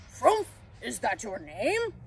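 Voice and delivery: slow, puzzled character question; Santa. A narrator's reading. Santa checking the pixie's name, slow and puzzled. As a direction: draw it out, puzzled.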